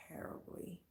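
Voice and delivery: breathy whisper, barely voiced. Real, not acted. Whispering about the hard breakup to the listeners. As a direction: let the word fall away to a whisper. Confessing a painful past breakup.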